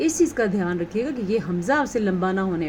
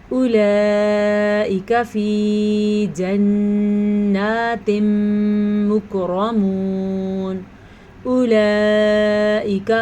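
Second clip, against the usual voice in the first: loud slow tajweed chant with long madd; Quran recitation; 5 dB louder, much slower than usual. Shown as a demonstration. Teacher reciting loudly for students. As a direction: project and hold the madd.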